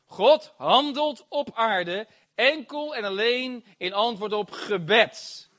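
half-speed, each phrase set apart; slow emphatic repeat. Real, not acted. Preacher repeating the key line to believers, slow and emphatic. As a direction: drive the key line home slowly.

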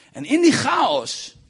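high, loud, slow proclamation; dramatic preaching. Acted. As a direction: stretch it out at full force. (dramatisch luid en langgerekt)

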